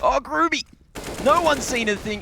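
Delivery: high, loud burst of excitement; sudden hype as the player pops out; excited caster call. Real, not acted. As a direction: jump on the play with a shout. Caster reacts to surprise play.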